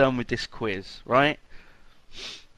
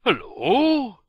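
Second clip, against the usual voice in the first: put-on Russian accent. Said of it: "We'll try and do a Russian accent".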